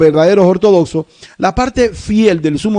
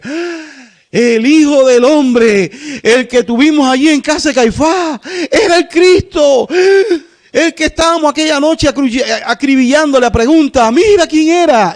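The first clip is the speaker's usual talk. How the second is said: stunned realization; 7 semitones higher than usual. Acted. Israel's realization at Christ's return.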